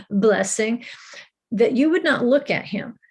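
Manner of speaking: ordinary delivery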